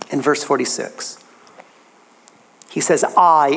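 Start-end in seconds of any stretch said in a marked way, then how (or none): 3.2-3.5 "I" hit louder as Jesus' own word; emphatic I. Acted. Preacher quoting Jesus to the congregation, emphatic "I". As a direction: lean hard on "I" alone. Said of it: "it's emphatic in the original".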